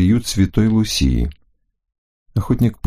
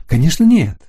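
higher and louder, eager; 9 semitones higher, 4 dB louder than usual. Acted. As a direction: blurt it out happily. Florinda eagerly agrees.

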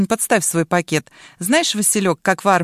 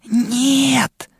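strangled high cry; nearly fainting; 6 semitones higher than usual. A narrator's reading. Choked boy denies lying, nearly fainting. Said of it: "почти теряя сознание".

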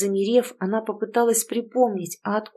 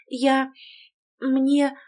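higher, halting false start; hesitant; 5 semitones higher than usual. A narrator's reading. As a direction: start and stall, unable to decide. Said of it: "не могла решиться она". (нерешительно, с паузами)

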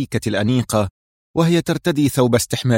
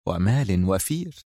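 softer, slow enticing tease; low, slow seductive promise; teasing wink; 3 semitones lower, 6 dB quieter, slower than usual. Acted. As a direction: dangle the bait slowly, with a wink. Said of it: "غمزت بعينها مضيفة".

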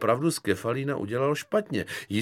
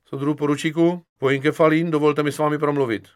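louder, higher formal military address; soldier reporting; 5 semitones higher, 6 dB louder than usual. Acted. Private asks lieutenant for a word, formal report. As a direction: report in crisply to a superior.

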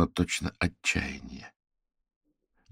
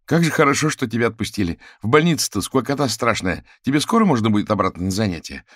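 higher, louder eager greeting; lively chatter; 6 semitones higher, 5 dB louder, faster than usual. A narrator's reading. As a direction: chatter brightly to a friend just let out. Friend chatting to released patient.

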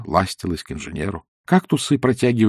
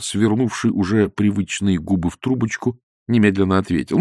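ordinary delivery, nothing marked

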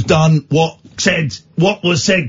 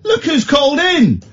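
high, stretched cry of discovery; theatrical delighted greeting; 10 semitones higher, slower than usual. Acted. Host hailing a new caller, high theatrical delight. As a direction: pounce on the surprise in a high voice.

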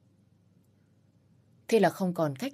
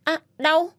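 sharp, much higher, loud pained cry; 10 semitones higher, 7 dB louder than usual. Acted. Woman yelping as he snaps her leg into place, pained cry. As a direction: yelp as the leg is snapped. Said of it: "Tôi la lên".

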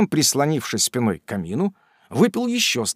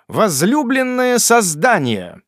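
theatrical reading; 9 semitones higher, 5 dB louder, slower than usual. A narrator's reading. Sam reads the letter.

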